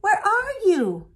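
slow, high, loud call. Acted. Grandfather searching for the twins, calling loudly. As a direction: shout it across the clearing. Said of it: "the voice cried".

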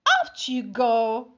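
firm dismissal. Acted. Family throws spider out.

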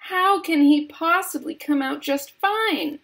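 high, indignant snap; cross mother's high-pitched challenge; snapped retort. A narrator's reading. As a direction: snap back in disbelief. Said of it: "snapped Missus Gloop".

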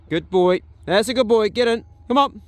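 high, loud doggy praise; praising the dog. Real, not acted. Owner praises and coaxes his dog in, high and loud. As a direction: coax him in with high praise.

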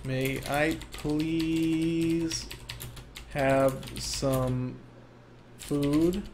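very slow, soft, drawn-out plea; put-on frail, hushed request; pleading old man. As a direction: beg feebly, stretching every word. Old man begging for food.

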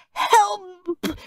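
high, strangled cry for help; high-pitched choked plea; spluttered cry. A narrator's reading. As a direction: splutter it as if being squeezed. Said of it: "spluttered Peter".

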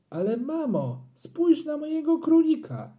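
put-on high, pleading little boy; pleading child. A narrator's reading. Boy begging mum to look at his rabbit, pleading. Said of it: "poprosiłem".

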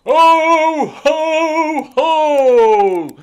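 very high, loud ho ho ho; belly-laugh as Father Christmas; laughing Santa. A narrator's reading. Santa laughing as the sleigh takes off. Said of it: "laughed Santa".

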